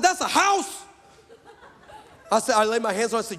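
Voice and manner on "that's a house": high-pitched comic shock; incredulous outburst. Retold or imitated. Preacher shocked by the doctorate's price, high comic outburst. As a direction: exclaim it with pitch way up.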